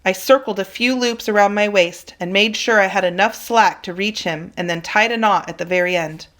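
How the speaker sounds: plain reading voice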